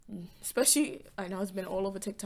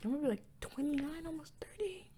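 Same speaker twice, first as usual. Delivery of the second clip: whispering; 6 semitones higher, 3 dB quieter than usual. Real, not acted. Dread at turning thirty.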